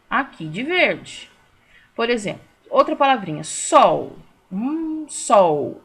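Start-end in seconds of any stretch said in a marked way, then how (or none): 3.4-4.2 SOL as one loud, low syllable; one strong beat. Shown as a demonstration. Teacher shows pupils sol is monossílaba, one strong beat. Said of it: "uma vez só". 5.1-5.8 SOL said once, loud and low; one strong beat. Shown as a demonstration. Teacher proves sol needs one mouth opening, one strong beat. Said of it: "uma vez só".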